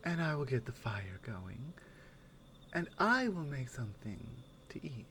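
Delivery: soft, low, gentle companion talking close; caring companion. Acted. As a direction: take care of the traveller softly, half-whispered at times. Soft in-character roleplay to listener.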